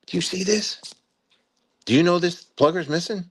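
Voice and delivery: pointed questions, pitched high; retold stern questioning. Commander grilling a lieutenant in his office, accusing.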